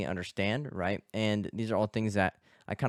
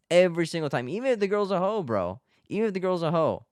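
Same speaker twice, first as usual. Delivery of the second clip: raised, high, louder exclamation; emphatic insistence; 8 semitones higher, 4 dB louder than usual. Real, not acted. Telling guys it's their fault every time, high and emphatic. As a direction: hammer it home in a high, raised voice.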